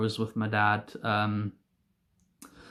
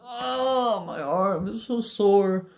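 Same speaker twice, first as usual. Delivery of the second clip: high, drawn-out moan sliding down; mock pained wail; 6 semitones higher, much slower than usual. Rider retelling a sore arm, mock pained wail. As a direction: whine it slowly, hamming up the hurt. Acts own pain after crash.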